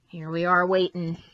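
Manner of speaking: ordinary delivery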